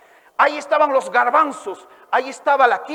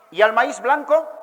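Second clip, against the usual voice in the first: usual speaking voice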